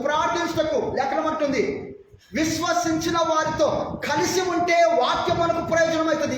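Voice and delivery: high, ringing preacher's exhortation; forceful preaching. Acted. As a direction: exhort the congregation in high, driving phrases. (ఉద్వేగంతో గట్టిగా ప్రబోధం)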